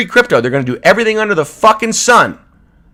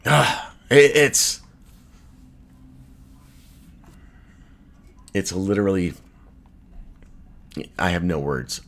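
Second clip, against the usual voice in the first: dejected; 8 semitones lower, 6 dB quieter than usual. Real, not acted. Crushed after the liquidation event.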